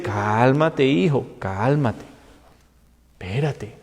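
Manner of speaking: soft, low, slow reassurance; quiet fatherly calm; God calming. Retold or imitated. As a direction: hush an overeager child gently. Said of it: "Dios le dice".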